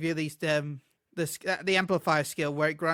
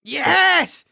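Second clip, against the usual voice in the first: excited yes; 10 semitones higher, 7 dB louder, much slower than usual. Real, not acted. Excited about favourite class.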